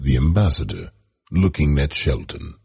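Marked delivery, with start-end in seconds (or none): none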